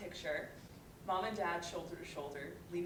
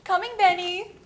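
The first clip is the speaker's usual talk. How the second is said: loud, high call to the door; mother calling out to her son at the door; 10 semitones higher, 17 dB louder than usual. Acted. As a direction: call through the house to your son.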